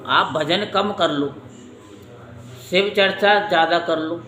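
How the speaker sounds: ordinary talk, nothing marked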